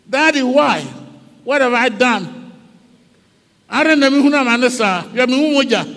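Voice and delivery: high, loud, pleading outcry; impassioned shouted plea; anguished cry from the cross. Acted. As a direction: cry out to a father who has abandoned you. Acts Christ's cry on the cross.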